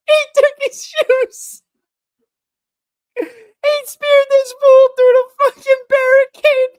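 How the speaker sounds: very high, loud hysterical squeal; laughing to tears. Real, not acted. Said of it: "I have tears in my eyes".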